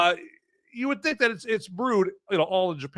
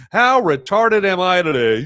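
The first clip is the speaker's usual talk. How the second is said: loud self-mocking; 5 semitones higher, 8 dB louder than usual. Real, not acted. Mocking himself loudly.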